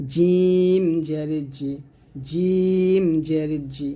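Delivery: জিম জের জি, drawn out slowly; জিম জের জি said slowly, the জ clear; slow demo of jim. Shown as a demonstration. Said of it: "জিহ্বাটা আমরা নিচে দাঁতে লাগাবো". (ধীরে জিম জের জি)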